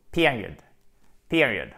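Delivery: "Period" stressed at the front, falling after; stressed first syllable. Shown as a demonstration. Teacher demonstrates the tonic accent just explained, first-syllable stress. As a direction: say "period" twice, strong first syllable each time. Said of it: "sur la première syllabe avec un i court".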